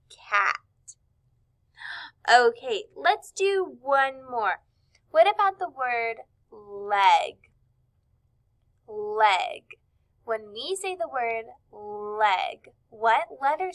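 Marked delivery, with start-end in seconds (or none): none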